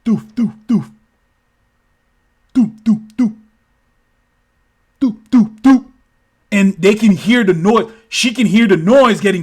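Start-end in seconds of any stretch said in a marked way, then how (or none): 0.0-5.9 approaching thuds. Retold or imitated. Landings approaching in story.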